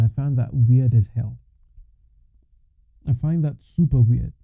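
usual speaking voice

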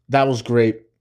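ordinary talk

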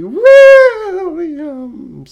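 high, loud, slow and strained; voice cracking. Acted. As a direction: let the voice break on it. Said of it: "Sorry. I got a little tickle in my throat".

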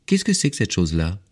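lightly voiced curious child; little prince. A narrator's reading. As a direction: ask with a hint of a small boy's voice. Little prince asks about plane.

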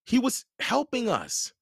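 put-on boy yelling back to sister; yelled back. A narrator's reading. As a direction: defend him. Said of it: "Jared yelled back".

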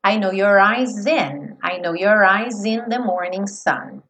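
"I know your eyes in the morning sun" slow, eyes in linked as eyez-in; sing-speak "I know your eyes in the morning sun" slowly; slow lyric. Shown as a demonstration. Teacher models the lyric. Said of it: "ganhando um som de Z".

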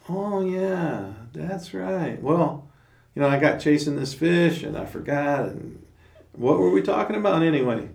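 high, loud, airy rambling excuse; scatterbrained Otter. Retold or imitated. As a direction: play the forgetful otter caught slacking. Forgetful Otter excuses himself.

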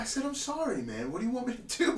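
high exasperated protest. Retold or imitated. Protesting to the stranger, high exasperated. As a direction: whine it up high. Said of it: "I'm like".

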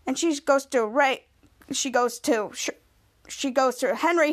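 plain reading voice

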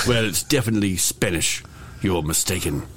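put-on British accent, politely insistent; mock British actor insisting he is Spanish. Actor denying he sounds like Dover, in a British accent. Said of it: "sound like you're from Dover".

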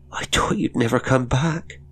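high, plaintive whimper. A narrator's reading. Frightened cook greets the returning governess, whimpering. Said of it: "she whimpered".

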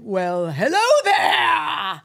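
loud, drawn-out diva greeting; hammed-up star's hello, swelling and high; Helen Lawson. Deluded star answering a Korean shopkeeper, grandiose diva. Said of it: "Like fucking Helen Lawson or something".

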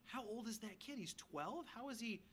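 lightly voiced astonished onlookers; a mild, wondering "how old is he"; imagined amazement. Teachers in the temple marvelling at the boy, mildly amazed. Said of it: "Everyone's probably thinking".

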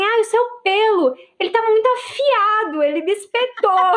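high, whiny mock of the boyfriend. Girlfriend mocking her boyfriend's complaint about her sharp hair, in a high whiny voice. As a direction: make him sound like a big baby. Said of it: "Ele falou assim".

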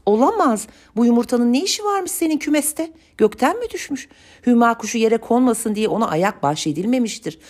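put-on high-pitched, agitated old woman's grumbling; scandalized old woman fussing aloud; fretful grumbling. A narrator's reading. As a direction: grumble in dismay, pitched well up. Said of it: "söyleniyordu yaşlı kadın".

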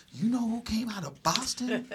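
a proud Bostonian boasting, in a put-on Boston accent. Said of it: "That's how you have to say it".

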